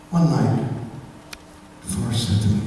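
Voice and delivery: ordinary talk, nothing marked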